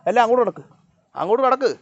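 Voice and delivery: loud, high commands to pets; high, loud herding call; ordering animals away. Real, not acted.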